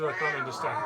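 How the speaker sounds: high exasperated shout. Acted. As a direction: yell it in frustration. Shouting at growling panther player.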